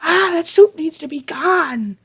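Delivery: very high-pitched whining outburst; hammed-up high wailing protest; mock tantrum. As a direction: imitate a little brother in a fit of rage. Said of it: "He's like".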